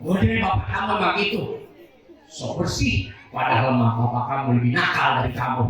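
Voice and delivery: fast, gossipy mock mother's voice; gossiping mother. Mother gossiping to child about father, fast and conspiratorial. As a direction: rattle it off like a mum gossiping to her kid. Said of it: "Dia malah ngegosip sama anaknya".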